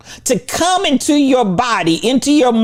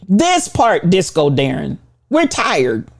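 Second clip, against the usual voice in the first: normal talking voice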